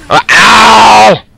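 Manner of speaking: loud high cry of pain; pained yelp. Real, not acted. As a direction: yelp as if struck. Gets hit by helicopter.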